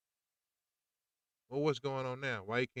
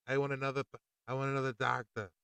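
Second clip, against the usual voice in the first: ordinary talk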